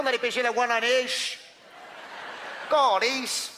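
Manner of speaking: loud, high hammed-up Québécois twang; exaggerated Quebec French yell; mock Québécois accent. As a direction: ham up a rural Québécois fishing buddy. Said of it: "Gaétan, qu'on dit Gaétan, pas Gaëtan".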